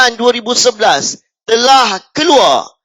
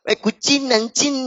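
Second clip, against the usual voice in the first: put-on northern Malay accent; 4 semitones higher, 7 dB quieter than usual. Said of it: "dia cakap".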